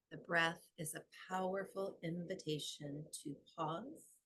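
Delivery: soft, unhurried meditation voice with long pauses; guided meditation. Acted. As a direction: guide them gently into the breath.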